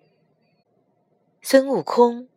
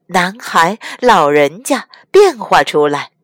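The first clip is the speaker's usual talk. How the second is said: usual speaking voice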